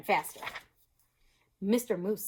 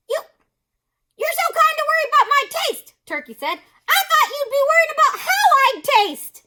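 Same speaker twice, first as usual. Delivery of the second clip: high, louder nervous little voice; Turkey; 14 dB louder than usual. Acted. Turkey nervously jokes with his hostess. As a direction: squeak it with a nervous laugh.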